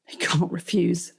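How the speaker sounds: usual speaking voice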